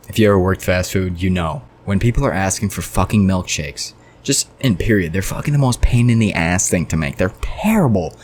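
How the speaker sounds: ordinary talk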